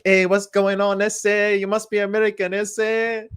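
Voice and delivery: high-pitched, sing-out caricature voice; jokey put-on American. Australian teasing Americans back, jokey put-on voice. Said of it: "And I'm just like".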